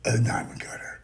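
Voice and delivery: hushed whisper, voice dropped; a secret whispered boast; whispered aside. Acted. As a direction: lean in and whisper it.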